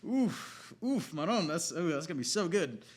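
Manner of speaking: high, excited mock self-congratulation; a high, hammed-up excited writer; smug writers. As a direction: gush like writers proud of their line. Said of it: "they were like".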